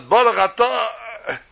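high, slow, drawn-out blessing; intoned blessing. Acted. Guest starting Kiddush outside the sukkah, intoning haltingly. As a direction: intone it and hang on the last syllable.

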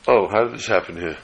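normal talking voice

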